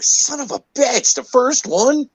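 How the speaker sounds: high-pitched squeak of disbelief; disbelieving outburst. Retold or imitated. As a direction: shriek it in outrage and disbelief. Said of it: "and I was like".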